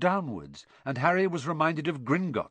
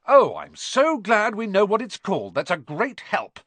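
loud, high-pitched sarcasm; snarling; 8 semitones higher, 6 dB louder than usual. A narrator's reading. Trapped boy to his know-all friend, snarling. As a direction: snap it back with heavy sarcasm. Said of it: "snarled Ron".

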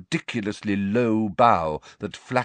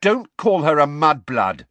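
loud, raised-pitch rebuke; very angry; 9 semitones higher, 9 dB louder than usual. A narrator's reading. Ron and Ginny defend their friend, very angry. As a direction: snap it out loud and high. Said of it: "very angrily".

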